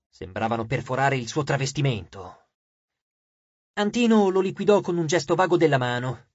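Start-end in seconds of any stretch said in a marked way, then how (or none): none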